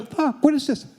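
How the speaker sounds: high, loud panicked cry; panicked yelp. Retold or imitated. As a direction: squeal it in sudden panic. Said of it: "I'm going".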